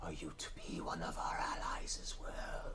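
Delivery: eerie whisper inside the mind; creepy whisper. A narrator's reading. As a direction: hiss it like a creepy voice in the head. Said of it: "a creepy whispered voice go".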